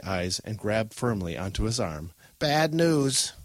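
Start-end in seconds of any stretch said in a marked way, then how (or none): 2.4-3.3 put-on higher, ominous old-woman voice; alarmed old woman. Grandmother reading her coffee cream, ominous warning. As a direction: warn them like a frightened grandmother. Grandma announces a bad omen.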